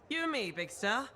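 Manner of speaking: gleeful tough talk. Acted. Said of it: "Not cracking heads".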